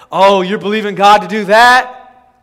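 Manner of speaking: loud scoffing question; mock the doubters with a loud, drawn-out taunt; scornful imitation of a skeptic; mocking naysayer. Mocks the dry boat talkers.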